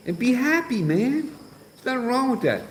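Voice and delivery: high-pitched playful ribbing; mock high teasing. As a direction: lift it way up, teasing. Teasing William.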